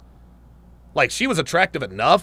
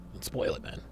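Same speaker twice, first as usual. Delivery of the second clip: whispered much softer and lower; whispered plea not to spoil it; hushed whisper; 11 semitones lower, 16 dB quieter than usual. Real, not acted. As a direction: drop to a secret whisper. Repeats plea, then hushed.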